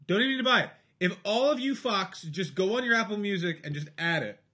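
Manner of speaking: worked-up high, loud plug; exasperated plea. Pretend. Guest pushing listeners to add his album, exasperated plea. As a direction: badger the audience in mock exasperation.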